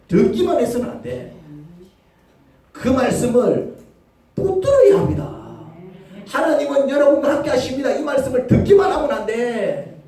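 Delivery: loud, rising preacher's cry; forceful preaching. Acted. Preacher urging the congregation, loud and forceful.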